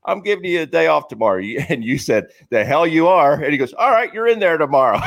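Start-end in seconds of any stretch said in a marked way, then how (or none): 0.1-1.4 high-pitched skipper's call; imitated manager. Manager at the locker granting a day off, imitated. 2.5-3.3 raised, louder retold retort; defiant refusal. Player refusing the manager's day off, mock defiant. 3.7-5.0 high, punchy skipper concession; imitated manager giving in. Manager relenting to the defiant player, acted punchline.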